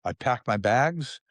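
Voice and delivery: ordinary talk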